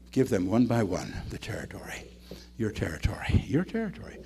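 hushed whisper handing each one over. Shown as a demonstration. Pastor hands each member a territory, whispering. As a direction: breathe it like a solemn gift.

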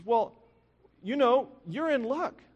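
high-pitched, encouraging secretary; kind secretary. Retold or imitated. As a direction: voice a secretary sharing good news, pitched well up. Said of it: "the secretary was very kind".

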